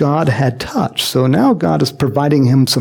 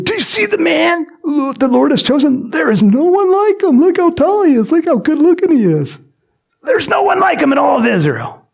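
proud announcement; 12 semitones higher than usual. Retold or imitated. Samuel presenting Saul to the people, high booming proclamation. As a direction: sell him like a ringmaster. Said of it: "he said to the people".

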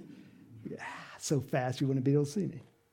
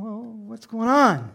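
raised, indignant questioning; mildly angry; 5 semitones higher, 7 dB louder, slower than usual. Acted. Older son coming upon the party, angry. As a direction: demand an explanation, a touch put out. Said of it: "you know, he's angry".

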